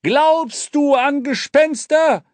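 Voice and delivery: high, slow called question; King calls back over his shoulder. Acted. King shouts a question over his shoulder to the boy, high and slow. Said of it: "brüllte der König über seine Schulter".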